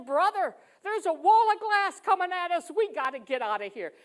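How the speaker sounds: loud, high urgent shout, easing at the end; urgent warning. Retold or imitated. Runner to a fallen stranger, urgently shouting. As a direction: shout a warning to get someone moving.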